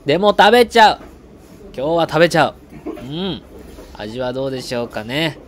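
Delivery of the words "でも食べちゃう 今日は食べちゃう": much louder and higher burst; excited exclaim. Acted. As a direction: psych yourself up to eat it. Loud high brave declaration.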